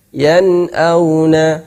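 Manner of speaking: yan'auna slow, clear nun; Quran recitation. Shown as a demonstration. Teacher giving nun sukun example.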